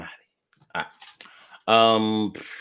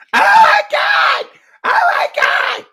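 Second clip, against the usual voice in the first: very high, slow shrieking cry; mock despair; 6 dB louder, slower than usual. Broke kid realizing no mall money, shrieking mock wail. Mocking kid's dramatic outburst.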